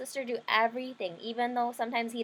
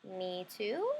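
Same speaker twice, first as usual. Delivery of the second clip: hopeful brother; 2 semitones lower, 6 dB quieter than usual. Acted. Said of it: "So I said".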